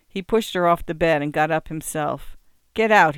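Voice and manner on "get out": short, firm get-out with a fall; a sick man ordering a visitor out; curt order. A narrator's reading. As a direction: tell her to leave, flatly firm. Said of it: "he said".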